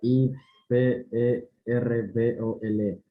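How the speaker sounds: letter by letter, evenly spaced; spelling it out. Shown as a demonstration. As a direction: spell each letter out separately.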